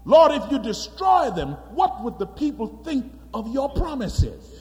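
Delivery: loud, high urgent pleading. Acted. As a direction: press the Lord hard. Moses pleading with God.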